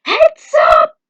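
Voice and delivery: piercingly high, very loud warning scream; alarmed shout. Acted. Lookout warns everyone of the blast, an alarmed scream. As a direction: yell a warning to duck.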